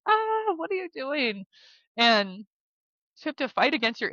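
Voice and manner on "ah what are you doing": high, slow mock alarm on the Ah; imitating a shocked driver, pitched way up. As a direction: play the startled drivers she cut off. Drivers honking at wrong-way driver.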